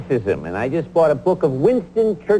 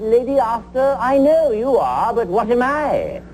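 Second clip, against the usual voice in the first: put-on British accent, raised pitch; Winston Churchill; 5 semitones higher than usual. Churchill answers the lady's insult, in a British accent. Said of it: "That's a great accent".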